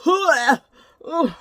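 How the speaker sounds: disgusted groans. Retold or imitated. Retelling disgust at the smell.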